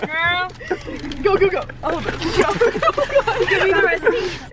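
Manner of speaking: loud excited cheering; excited hype. Real, not acted. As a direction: hype her up, loud and high.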